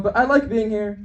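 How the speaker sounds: ordinary delivery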